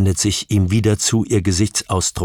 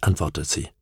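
ordinary delivery

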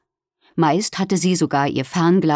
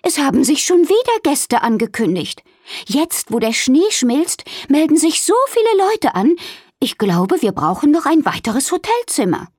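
higher, eager voice for Wilma; 8 semitones higher than usual. A narrator's reading. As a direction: lift the voice into a busy hedgehog hostess.

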